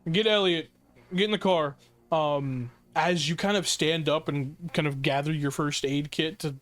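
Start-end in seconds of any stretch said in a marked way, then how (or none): 0.1-1.8 raised, urgent call to get in the car. Acted. Panicked traveler urging a companion into the car, raised and urgent. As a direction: hurry them into the car.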